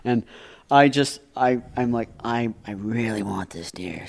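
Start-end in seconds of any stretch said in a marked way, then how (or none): 2.2-4.1 soft, slow, earnest undertone; hushed longing. Acted. Young hunter beside dad, wanting the deer, hushed and slow.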